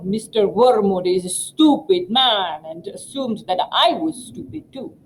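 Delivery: a quick sample of a Russian accent, lightly done. Shown as a demonstration. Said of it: "give it your best Russian dialect".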